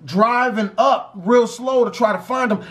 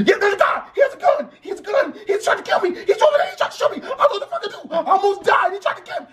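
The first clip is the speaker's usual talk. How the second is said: very high squealing mock hysteria; screaming, blubbering mess; 11 semitones higher, much faster than usual. Brother reporting the gunman to police, hammed-up hysterical. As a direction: babble in exaggerated panic, way up high. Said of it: "a screaming and blubbering mess".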